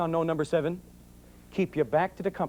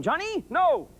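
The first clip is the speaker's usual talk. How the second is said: warning first name; 9 semitones higher, slower than usual. Retold or imitated. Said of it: "you throw in that first name".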